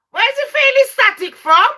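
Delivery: high-pitched, loud disbelief; incredulous challenge. Real, not acted. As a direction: demand it, voice shooting up.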